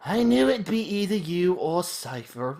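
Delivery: lightly voiced posh teacher, slow; a touch of posh for the instructor; a hint of posh teacher. Teacher to her student, a hint of posh voice. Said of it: "I always imagine Quist is having a bit of a posh voice".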